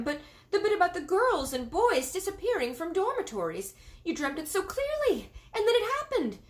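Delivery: a fretful maid insisting on the dream; anxious maid. A narrator's reading. Maid tells the queen her dream came true, worried and insistent.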